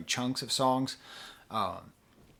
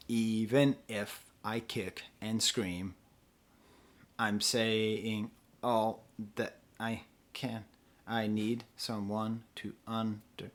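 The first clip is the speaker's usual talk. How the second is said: slow, soft, evenly spaced syllables, "saying" split in two; iambic scansion; 3 semitones lower, 6 dB quieter, much slower than usual. Shown as a demonstration. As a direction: count out "Even if I kick and scream" beat by beat, quietly. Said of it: "first line is in iambic pentameter".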